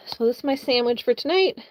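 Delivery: ordinary talk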